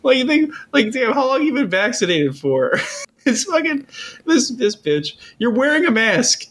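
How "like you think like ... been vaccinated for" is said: much higher, laughing disbelief; exasperated laughing rant. Retold or imitated. Telling off the masked store worker, laughing and high. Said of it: "And I'm like".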